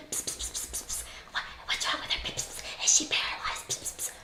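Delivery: hushed gossiping whisper, almost no voice; mocking boys whispering. Boys on the boardwalk whispering about the girl in the wheelchair, hushed. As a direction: whisper it like boys gossiping behind her back.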